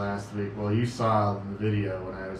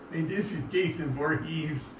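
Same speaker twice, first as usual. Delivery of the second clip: funny, higher-pitched character voice; Jason Voorhees; 6 semitones higher than usual. Retold or imitated. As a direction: put on a silly voice for the introduction.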